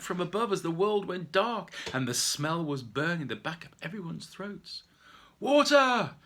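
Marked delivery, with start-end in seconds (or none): 5.4-6.2 high loud call; shouted. Acted. Someone in the forest fire shouting for water, loud. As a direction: bellow it across the smoke. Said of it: "someone shouted".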